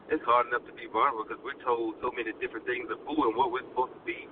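usual speaking voice

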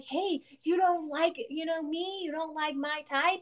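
high, slow mock of a pushy stranger; mock catcaller. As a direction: imitate the man asking if she dislikes his type. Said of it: "and he was like".

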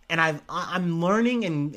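ordinary talk

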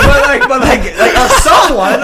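words broken up by laughter; laughing. Real, not acted. Laughing while giving advice.

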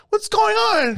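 very high-pitched, indignant; mock high female voice; imitated wife. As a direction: shoot the pitch way up like an alarmed wife. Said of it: "She's all like".

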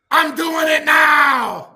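slow, high, loud declaration; excited shout. Real, not acted. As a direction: shout it out, fired up. Excited, acting on it right away.

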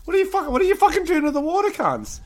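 high, fast ranting outrage; high-pitched rant. Retold or imitated. Ranting incredulous at countries.

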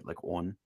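on said as wun, Maryland vowel. Shown as a demonstration. Maryland man demonstrates his accent, rounded vowel. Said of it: "some of the words I say".

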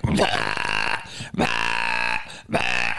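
low, drawn-out retching bleats; imitated vomiting. Storyteller showing it hitting the wall, low retching noises. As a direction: heave out repeated mock retches.

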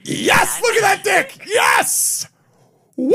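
high, loud shouted whoops; over-enthusiastic cheering. Acted. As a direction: act wildly thrilled, building to a screamed woo. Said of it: "I want a chick that's like".

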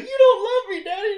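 very high, loud mock kid wail; hammed-up child's protest; upset child impression. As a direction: wail it like a hurt little kid. Upset child in retold scene.

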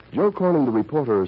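normal talking voice

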